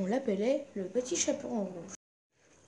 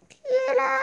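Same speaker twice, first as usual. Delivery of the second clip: wolf asking who is there, pitched much higher; a voiced wolf calling through the door, much higher; wolf's voice; faster than usual. Wolf in grandmother's bed answers the knock in a put-on voice. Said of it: "la grosse voix du loup".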